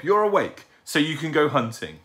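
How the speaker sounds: put-on high, loud child's protest; insistent young owlet. A narrator's reading. Owlet catching out his sleeping father, insistent. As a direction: pipe it up high, accusing.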